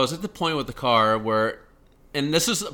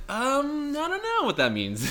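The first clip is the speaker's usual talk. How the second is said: clueless, baffled; 11 semitones higher, 4 dB quieter, slower than usual. Retold or imitated. Retelling being baffled by technical questions.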